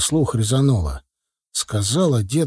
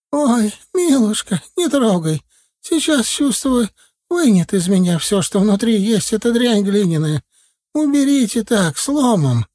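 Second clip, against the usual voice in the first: put-on wailing old man; 12 semitones higher, 4 dB louder than usual. A narrator's reading. Дед причитает, умоляя снять горшок, тягучим стоном. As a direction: wail like a suffering old man.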